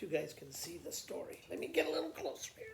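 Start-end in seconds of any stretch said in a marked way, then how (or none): 1.5-2.7 whisper. Real, not acted. Teacher leaning in to the camera.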